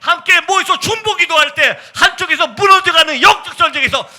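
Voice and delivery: loud, high climactic exhortation; 열정적인 설교. Real, not acted. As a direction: build to a fervent, shouted preaching climax. Preaching climax. (절정으로 치닫는 힘찬 설교)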